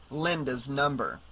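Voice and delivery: ordinary delivery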